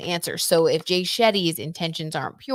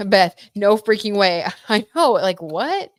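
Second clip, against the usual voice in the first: excited disbelief; 3 semitones higher, 7 dB louder than usual. Real, not acted. Shocked card reveal to friend.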